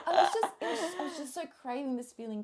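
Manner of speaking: laughing. Real, not acted. Laughing while recalling.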